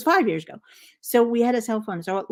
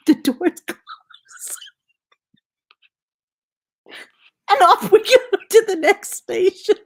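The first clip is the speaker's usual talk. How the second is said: breaking up with laughter, higher and louder; laughing; 14 semitones higher, 6 dB louder than usual. Real, not acted. Telling viewers the train story, laughing helplessly through it. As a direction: squeeze the words out through laughter. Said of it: "I was already laughing".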